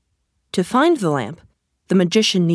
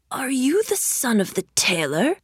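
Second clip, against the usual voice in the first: stranger voice; 6 semitones higher, 4 dB quieter than usual. A narrator's reading. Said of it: "said the stranger".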